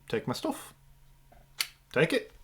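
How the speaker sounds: put-on soft, tentative Irish accent; attempted Irish accent. Game master voicing the disarmed fighter giving in, attempted Irish accent. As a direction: offer it quietly with an Irish lilt. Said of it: "how to do this fucking accent".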